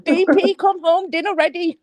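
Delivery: put-on Indian accent. Shown as a demonstration. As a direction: call someone home in a mock Indian accent. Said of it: "Can you do an accent?".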